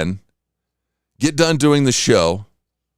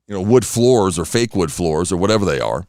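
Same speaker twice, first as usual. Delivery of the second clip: normal talking voice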